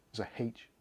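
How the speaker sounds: H said as haitch with a breathy h; Bristolian haitch. Shown as a demonstration. In Bristol we say it, so haitch. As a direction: say haitch with the h sounded, Bristol style. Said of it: "In Bristol we say H".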